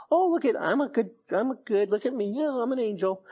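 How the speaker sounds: high, preening mock voice; mock boaster. Preacher mocking a showy believer, high and preening. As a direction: lampoon a smug self-praiser.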